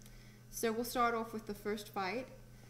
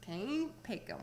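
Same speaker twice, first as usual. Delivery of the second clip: trying southern accent; much slower than usual. Shown as a demonstration. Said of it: "how would I say it with a southern accent".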